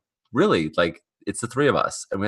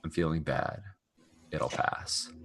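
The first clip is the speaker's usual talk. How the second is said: barely voiced, much softer, deadpan; flat quiet Prophet; 4 semitones lower, 12 dB quieter, slower than usual. Prophet answering Reina, a soft deadpan mutter. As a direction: mutter it like April from Parks and Rec. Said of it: "she's like".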